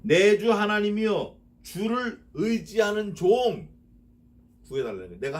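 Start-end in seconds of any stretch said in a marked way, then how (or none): 0.0-3.7 loud, higher, slow pleading verse; slow fervent psalm cry; fervent verse intoning. Acted.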